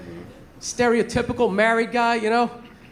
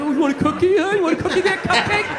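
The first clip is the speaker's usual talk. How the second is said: sneering, higher mock taunt at the cookie guy; 5 semitones higher than usual. Comic imagining taunting the counter guy, sneering mock bully. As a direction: jeer it like a bully picking a fight.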